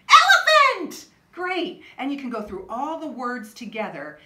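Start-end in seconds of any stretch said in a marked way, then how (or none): 0.1-1.1 big elephant finish. Real, not acted. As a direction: build to a loud high laughing elephant.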